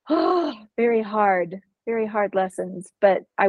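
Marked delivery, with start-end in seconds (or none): 0.1-0.5 sharp high gasp before the "oh"; a pained gasp, then "oh". Real, not acted. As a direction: suck in breath as if stung. Recalling hard lessons.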